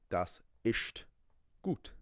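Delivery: a lightly mimicked Das ist gut with ist said as isht; mock a learner saying isht for ist; imitating his isht. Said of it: "he would say".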